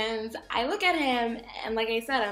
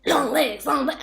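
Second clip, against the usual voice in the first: loud, high street catcall; catcall shout; 6 semitones higher, 11 dB louder than usual. Retold or imitated. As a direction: holler it at her. Catcall retold again.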